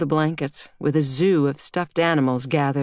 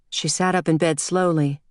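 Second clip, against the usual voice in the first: usual speaking voice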